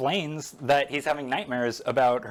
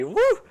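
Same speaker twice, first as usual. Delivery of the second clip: loud, high "woo"; high whoop; 8 dB louder than usual. Real, not acted. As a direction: let out a quick high whoop. Whoops after losing her place.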